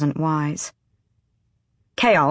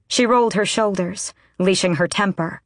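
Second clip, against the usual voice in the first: plain reading voice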